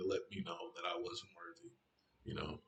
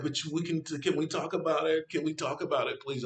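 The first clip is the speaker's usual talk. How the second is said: pleading; 8 semitones higher, 4 dB louder than usual. Retold or imitated. Acting out her pleading.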